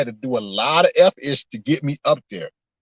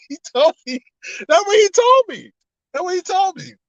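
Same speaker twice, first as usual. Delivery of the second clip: high-pitched, squealing through laughter; laughing insistence. Real, not acted. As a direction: insist on it while cracking up. Insisting while cracking up.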